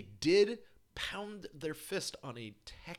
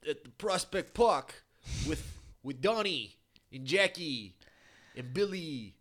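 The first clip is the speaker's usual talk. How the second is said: put-on old Brooklyn accent. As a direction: drawl it like a Brooklyn old-timer. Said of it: "Old-timey Brooklyn".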